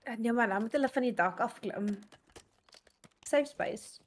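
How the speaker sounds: ordinary delivery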